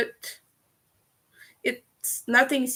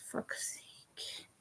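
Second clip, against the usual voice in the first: whispered curse; 22 dB quieter than usual. Real, not acted. Muttered curse after venting.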